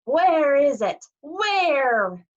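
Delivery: high, loud demand, drawn out at the end; hissing Furies. A narrator's reading. Furies lashing whips, hunting the boy, high hissing demand. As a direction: screech it out as a hunting monster.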